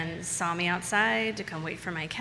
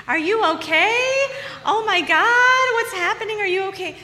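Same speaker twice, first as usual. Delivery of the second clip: put-on frantic drunk woman; 14 semitones higher, 8 dB louder than usual. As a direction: gush over her like a tipsy stranger. Drunk woman fussing in cab.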